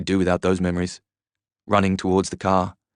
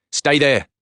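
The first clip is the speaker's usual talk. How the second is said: raised, urgent order; acted shout, pitched up; 6 semitones higher, 4 dB louder than usual. A narrator's reading. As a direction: shout a warning to stay back. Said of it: "he shouted".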